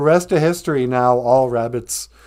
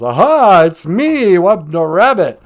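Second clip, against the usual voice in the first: loud gloating cry, pitched up; triumphant taunt; 6 semitones higher, 10 dB louder than usual. Acted. Rabbit throwing off the shawl to jeer at the fox. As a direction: shout it like the trickster caught him again.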